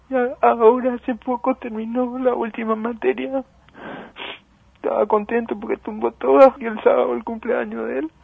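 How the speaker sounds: a mother barely holding back tears; grief-stricken. Real, not acted. Mother remembers her dead son.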